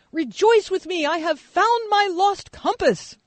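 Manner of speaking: put-on the boy announcing his find to everyone; little kid. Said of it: "this little kid yesterday being like".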